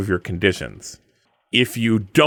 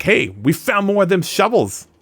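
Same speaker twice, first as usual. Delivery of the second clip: dumb henchman; 9 semitones higher than usual. Retold or imitated. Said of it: "And they're like".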